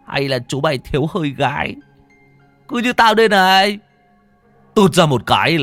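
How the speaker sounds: teasing. A narrator's reading. Toàn ribbing a friend who cannot pee, teasing. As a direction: tease him, getting loud and cocky. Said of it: "Anh còn ghẹo".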